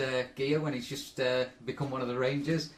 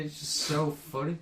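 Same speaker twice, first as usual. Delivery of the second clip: usual speaking voice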